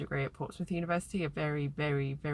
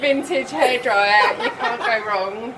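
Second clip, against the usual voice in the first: slow, loud laughing delight; 7 semitones higher, 12 dB louder, slower than usual. Real, not acted. Laughs over vintage hairdryer.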